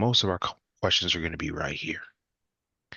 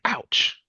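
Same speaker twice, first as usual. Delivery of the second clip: imitated yelp of pain; 13 semitones higher than usual. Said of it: "And then he said".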